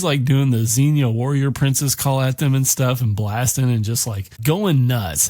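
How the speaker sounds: plain reading voice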